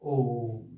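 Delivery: ओम with the o held extra long; sustained ओम, stretched beyond a long vowel; prolonged vowel. Shown as a demonstration. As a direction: draw out ओम as in reciting it. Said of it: "जब हम ओम का उच्चारण करते हैं".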